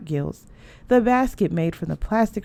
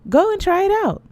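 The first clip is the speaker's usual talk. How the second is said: high, loud eager push; eager urging; 13 semitones higher, 5 dB louder than usual. A narrator's reading. Mother urging daughter to try the glove, excited. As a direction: urge her on brightly, much higher.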